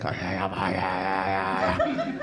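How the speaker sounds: low, soft panting wolf; breathy mock-animal yips, under the breath; wolf noises. Wolf seen through a clearing, panting noises. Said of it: "you see a wolf, and it's like".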